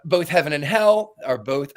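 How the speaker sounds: normal talking voice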